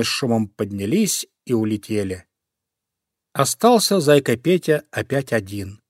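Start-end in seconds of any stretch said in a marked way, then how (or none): none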